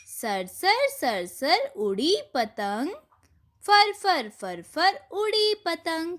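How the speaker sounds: put-on slow, sing-song rhyme for children; poem recitation. Shown as a demonstration. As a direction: recite the kite poem with lilting swings. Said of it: "एक बार हम इसको भी पढ़ते हैं". (बच्चों के लिए पतंग की कविता, धीमी लय में)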